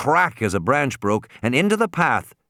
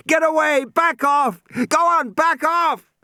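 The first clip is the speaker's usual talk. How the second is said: loud, high desperate yell; shouting at the top of lungs; 4 dB louder than usual. A narrator's reading. Regis yelling at the young tyrannosaur, full shout. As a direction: bellow it to drive the beast off. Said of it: "Regis was shouting at the top of his lungs".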